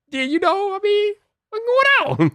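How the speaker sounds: high falsetto exasperation; comic high-pitched disbelief; exasperated squeal. Real, not acted. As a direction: go way up high, stretching out the question. Mock outrage over missing four K.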